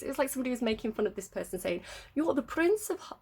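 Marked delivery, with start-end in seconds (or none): 2.1-3.2 mock posh English accent, lifted pitch; mocking a posh English toff. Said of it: "in a very posh voice".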